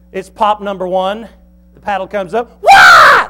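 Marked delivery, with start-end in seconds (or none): 2.6-3.3 sudden very loud, high fake cry; hammed-up burst of crying; fake child's wail. Shown as a demonstration. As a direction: bawl like a faking kid before the paddle lands. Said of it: "like that".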